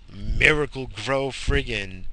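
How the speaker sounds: ordinary talk, nothing marked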